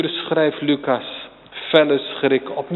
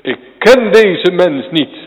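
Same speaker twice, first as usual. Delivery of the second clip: loud, high denial, spat out; swearing oath; 4 semitones higher, 10 dB louder than usual. Acted. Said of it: "En te zweren".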